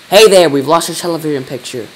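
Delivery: loud, indignant call over the radio. A narrator's reading. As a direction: call out a complaint loudly. President calls out over the radio.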